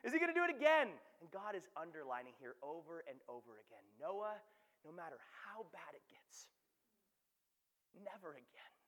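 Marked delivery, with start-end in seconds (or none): 0.0-1.0 laughing loudly, high; giggling through the words. Real, not acted. Laughing at Noah's panic. 4.0-8.7 soft whispered promise; reassuring whisper. Acted. God reassures Noah softly.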